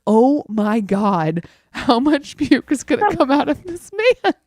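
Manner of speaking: slow start, rising into exasperated disbelief; retold horrified disbelief. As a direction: act out appalled amazement. Retold horror at repeated vomiting.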